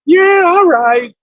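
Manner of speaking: high, slow, drawn-out mock agreement; coy reply. Woman agreeing to give her number, coy reply. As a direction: stretch it out in a put-on lilt.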